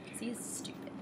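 ordinary talk

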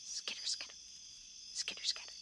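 whispered skitter skitter. A narrator's reading. Narrator whispering the mouse inside the house. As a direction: breathe it like a scurrying mouse.

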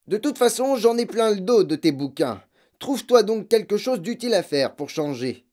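high, loud furious scolding; angry scolding. Acted. Father berating his daughter about books, loud and fed up.

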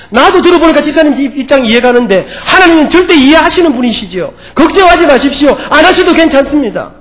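loud, high-pitched preaching voice; emphatic reassurance. Retold or imitated. Pastor telling a deaconess not to worry, loud emphatic reassurance. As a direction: proclaim the reassurance at full force, as the retold pastor.

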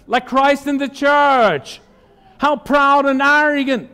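high-pitched rebuking exhortation; preacher's indignant challenge; impassioned preaching. Real, not acted. Preacher rebukes proud married couples.

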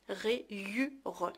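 "Rayure" softly sounded out in three beats; syllable by syllable. Shown as a demonstration. Teacher reviewing the y sound, syllable by syllable. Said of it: "هنا شفنا بان لو ايكغيك كيتقرا ي".

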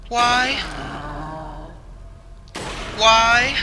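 panicked yelling. Real, not acted. Gamer chased by the monster yells why again and again. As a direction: cry out why in alarm.

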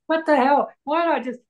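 high-pitched comic exasperation; high, jokey what-the-heck delivery; joking bravado. Retold or imitated. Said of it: "he just got me just laughing".

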